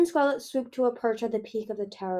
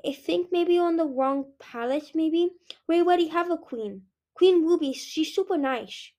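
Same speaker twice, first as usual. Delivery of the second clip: put-on a higher, childlike voice, guileless; politely correcting the queen; innocent little dragonette; 7 semitones higher than usual.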